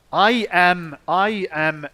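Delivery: loud, slow, hammed-up Finnish accent. Shown as a demonstration. Streamer showing how Finns would say it, loud Finnish accent. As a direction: punch each word in a thick Finnish accent. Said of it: "That's how we would say it".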